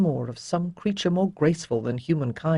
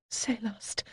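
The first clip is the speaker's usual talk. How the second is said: breathed name, much softer; whispered name; 5 semitones higher, 12 dB quieter than usual. A narrator's reading. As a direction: whisper her name before confessing. Tender confession of love.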